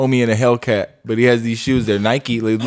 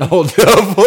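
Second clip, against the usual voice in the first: laughing out the words, high and loud; 9 semitones higher, 4 dB louder than usual. Real, not acted. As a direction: burst into laughter mid-phrase. Laughing at rhino joke.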